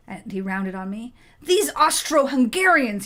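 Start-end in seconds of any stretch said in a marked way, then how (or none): 1.4-3.1 loud slow raging yell. Acted. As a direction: spit it out in fury. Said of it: "he raged in a peasant-accented French".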